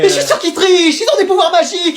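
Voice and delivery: very high-pitched panicked accusation; hysterical outburst. Acted. Exam supervisor convinced the twins have magic, hysterical high cry. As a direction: lose it completely, voice soaring high.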